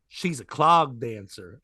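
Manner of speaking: low, slow retold admission; reluctant reveal.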